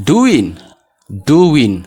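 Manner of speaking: DOING as "doo-win", high falling demo; silent G. Shown as a demonstration. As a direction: drop the final g of doing: "doowin". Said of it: "gaa otoo hin dubbisiin". ("doing" gaa malee, "duwin")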